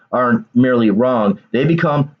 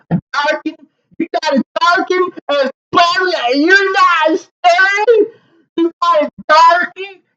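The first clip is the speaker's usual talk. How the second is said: high, strained caricature voice, slow and outraged; 13 semitones higher than usual. Retold or imitated. Venting as a bigoted supporter, high and aggrieved. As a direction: ham up a bigoted supporter crying for retribution. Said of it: "to portray the mentality of these people".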